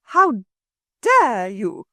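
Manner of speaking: put-on furious woman at the children's offer; shaking with anger. A narrator's reading. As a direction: choke out the outrage. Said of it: "her voice shook with angriness".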